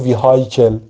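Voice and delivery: "ویهایکل" with a sounded H and an "eye" vowel; mispronounced vehicle. Shown as a demonstration. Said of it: "معلممون قدیما برام بد تلفظ کرده بود".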